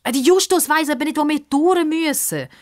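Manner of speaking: put-on fast, high girl cutting in; high hurried girl's voice; quick interruption. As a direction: jump in fast before he can finish. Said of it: "unterbricht ihn Pippa schnell".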